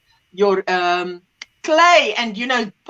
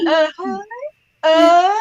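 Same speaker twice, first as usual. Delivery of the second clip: higher, noncommittal uh-huh; feigned interest; 6 semitones higher, much slower than usual. Retold or imitated. Mother humouring her daughter, disinterested. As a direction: humour them with a token response. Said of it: "So, so disinterested".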